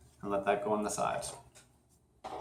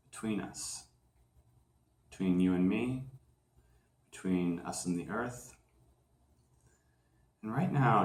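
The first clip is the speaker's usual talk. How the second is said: guided meditation; 2 semitones lower, 6 dB quieter than usual. Acted. Host guiding listeners to feel gravity's pull, calm meditation voice. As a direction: lead them gently down into grounding, leaving room to breathe.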